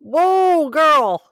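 high, loud drawn-out exclamation; shocked reaction. Real, not acted. Listener reacting to girlfriend's outburst, shocked. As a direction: recoil in amused disbelief.

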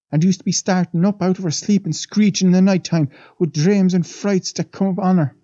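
a lightly voiced old maid, awed; old Irish housemaid. The maid leans in to the young men, lightly voiced and awed. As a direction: tell it like a wide-eyed servant gossiping.

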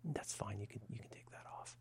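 hushed, voiceless whisper. Retold or imitated. Whispering his reply so the waiting room won't hear. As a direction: whisper it so nobody in the lobby hears. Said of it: "Speaking very quietly here".

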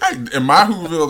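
ordinary talk, nothing marked

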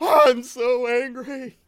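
loud, high, slow tearful wail; mock crying. Crying man in a fight, loud fake bawling. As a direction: bawl it out in hammed-up sobs. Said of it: "I'll cry the entire time".

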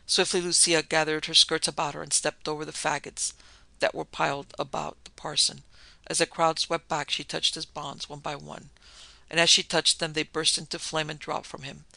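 usual speaking voice

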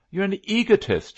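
high, loud taunting shout; heartless shout. A narrator's reading. Captain taunting the narrator, heartless shout. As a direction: shout it at him without pity. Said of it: "shouted heartlessly".

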